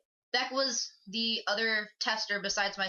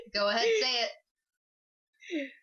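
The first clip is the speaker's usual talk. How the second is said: drawn-out coaxing, laughing; slow teasing; 3 semitones higher, much slower than usual. Real, not acted. Coaxing a friend to say the catchphrase slowly. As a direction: stretch it out to egg him on. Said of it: "teasing, laughing".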